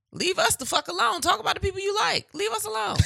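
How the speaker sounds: high-pitched, exasperated plea. Real, not acted. Exasperated outburst.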